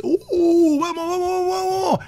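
high, excited cheering of the song; joyous party shout, way up high; excited singalong cry. Retold or imitated. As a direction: belt it out like the party just started. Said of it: "no decís".

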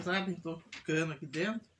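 ordinary talk

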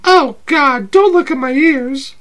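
playful date voice, high and bright; high girly voice. Acted. S0 holds Abby voice.

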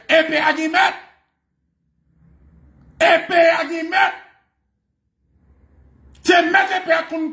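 high-pitched, agitated preacher's rant; heated preaching. Real, not acted. Preaching at full force. (prèch cho, vwa wo)

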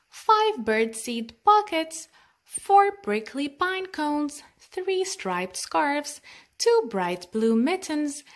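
usual speaking voice

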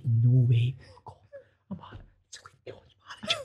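hushed disbelief under the breath; stunned whisper. Retold or imitated. Telling the presenter of a prank call, stunned whisper. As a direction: whisper it as if you can't believe it.

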